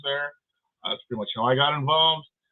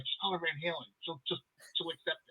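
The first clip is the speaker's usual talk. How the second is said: quiet aside; 2 semitones higher, 13 dB quieter than usual. Retold or imitated.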